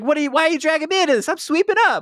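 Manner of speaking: put-on high-pitched rushed whine of a bothered teen; mock annoyed girl. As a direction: squeak out a put-upon protest. Voicing Mackenzie's annoyed reply.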